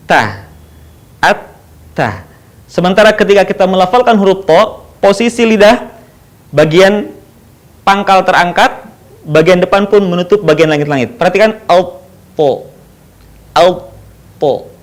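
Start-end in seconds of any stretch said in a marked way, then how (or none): 0.1-2.3 "Ta" light, with the tongue low and the mouth open; a thin, light "ta". Shown as a demonstration. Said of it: "posisi lisannya terbuka". 11.1-14.7 "alpo" with a heavy, rounded vowel and the tongue raised; a dark, full "alpo"; thick to. Shown as a demonstration. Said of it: "ketika melafalkan huruf to, suaranya menebal".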